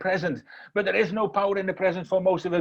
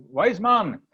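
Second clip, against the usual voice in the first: mildly raised call at a door; 4 semitones higher, 3 dB louder than usual. Acted. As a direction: call for someone inside, higher pitch. Boys calling at the door.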